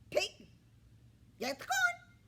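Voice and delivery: very high, loud, fast hen squawk; squawky hen. A narrator's reading. Scruffy hen haggles with the pig, squawking high.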